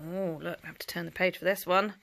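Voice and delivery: plain reading voice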